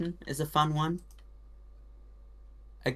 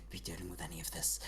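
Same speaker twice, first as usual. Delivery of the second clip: quick hushed aside; whisper; 7 semitones lower, 16 dB quieter, faster than usual. Acted. Mouse King muttering to himself, whispered. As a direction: drop to a whisper mid-speech. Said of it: "whispered aside in character".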